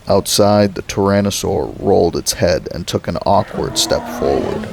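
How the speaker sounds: ordinary delivery, nothing marked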